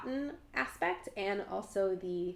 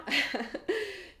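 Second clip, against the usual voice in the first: embarrassed laugh; 11 semitones higher than usual. Real, not acted. Laughs at own French attempt.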